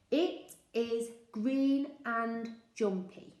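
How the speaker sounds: slow, word by word; slow, even repetition; slow rehearsal. Shown as a demonstration. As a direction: rehearse the sentence slowly. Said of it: "I said it a few times".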